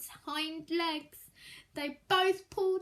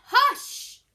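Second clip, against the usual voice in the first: sharp loud high hush; family hushing; 8 semitones higher, 10 dB louder than usual. Acted. Family shushing the curious child, loud sharp hush. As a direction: snap it out to silence him. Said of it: "all his family just said".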